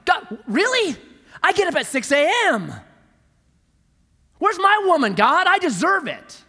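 high, loud indignant whine at God; whiny complaint to God. Retold or imitated. Single man whining loudly to God. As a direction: complain to God like a jealous bachelor.

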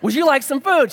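a chef calling out a friendly offer; cheerful chef. Retold or imitated. Chef offers food to wife.

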